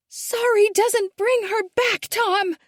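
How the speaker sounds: very high, slow, furious shout. A narrator's reading. Daughter shouting at her father, high and furious. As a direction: hurl it at him. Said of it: "Isabel's shout".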